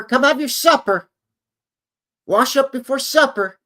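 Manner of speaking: high, loud mom hollering out the back door; mother calling kids in. Pretend. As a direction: call Frankie home for supper at the top of your voice.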